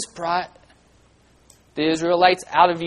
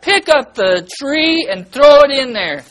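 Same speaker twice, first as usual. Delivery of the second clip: loud, high, theatrical command; voice of God; 8 semitones higher, 7 dB louder than usual. Acted. God instructs Moses in a children's lesson, loud commanding call. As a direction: proclaim the order grandly and loudly. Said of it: "Moses is told by God".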